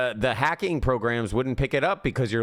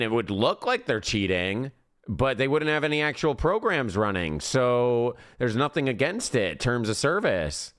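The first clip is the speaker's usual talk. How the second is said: normal talking voice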